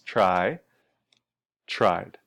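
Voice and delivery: low "Try", then "tried" with voiced "d"; "tried" in one syllable ending in "d"; D ending demonstrated. Shown as a demonstration. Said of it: "That one vibrates".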